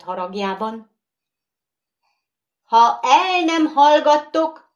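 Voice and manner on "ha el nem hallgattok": high, loud threat; angry shout. Acted. Bogeyman silencing the noisy skittles, angry shout. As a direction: yell to silence them. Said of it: "kiáltotta".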